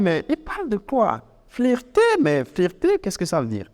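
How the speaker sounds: high-pitched baffled questioning, dropping away; puzzled listener. Acted. Francophone listener hears an unknown word, puzzled questioning. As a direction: act out not understanding the word. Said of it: "francophone, on va dire".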